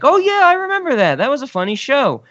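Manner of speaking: high-pitched, eager mock recognition; imitated enthusiasm. Podcaster quotes roommates reacting to the show, imitated excitement.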